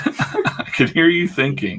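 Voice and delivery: says it again through a laugh. Shown as a demonstration. Teasing a friend, laughing.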